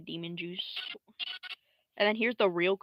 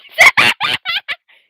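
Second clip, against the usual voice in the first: loud, high-pitched cackle; burst of laughter; 22 dB louder than usual. Real, not acted. Creator cracks up at own animation, loud shrieking laugh. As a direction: crack up helplessly.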